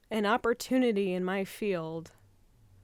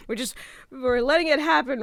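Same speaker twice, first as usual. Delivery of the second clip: louder, voice cracking with tears; crying; 5 semitones higher, 5 dB louder, faster than usual. Real, not acted. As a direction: let the tears break through. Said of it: "I'm crying right now".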